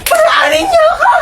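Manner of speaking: very high-pitched shriek; shrieking, at the end of all patience; frantic scream. Acted. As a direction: scream to be let through. Screams to be let through.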